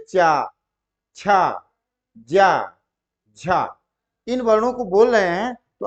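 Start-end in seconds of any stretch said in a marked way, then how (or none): 0.1-3.8 चा, छा, जा, झा separately with long vowels; letter demonstration. Shown as a demonstration. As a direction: sound out each syllable, tongue touching the palate. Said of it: "जैसे कहते हो".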